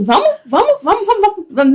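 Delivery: high, excited urging with a laugh; pep him up like a cheerleader. Retold or imitated. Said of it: "eu falo pra ele assim".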